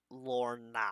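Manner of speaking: normal talking voice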